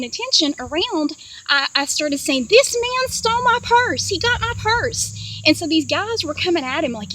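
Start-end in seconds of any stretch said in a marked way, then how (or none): 2.5-5.1 high, slow public alarm call. Retold or imitated. Dreamer alerting bystanders to purse thief, calling out. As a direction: announce it to everyone around, pitched way up.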